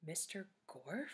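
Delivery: hushed, slow, worried-sounding question; muttered question. A narrator's reading. Joy to friends on the stairs, muttered. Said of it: "muttered Joy".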